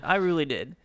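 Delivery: ordinary talk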